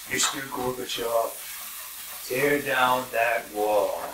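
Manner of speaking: slow, measured presidential voice; Reagan impression. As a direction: drawl it like an old president. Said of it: "impressions ... my dad did".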